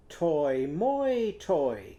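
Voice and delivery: sing-song the oi syllables of tomato; playful "Toy, moi, toy" demo; oi in each syllable. Shown as a demonstration. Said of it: "what's it going to sound like?".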